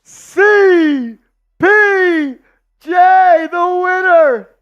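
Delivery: loud, high winner announcement; hyped giveaway announcement. Real, not acted. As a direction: belt out the winner like a ring announcer.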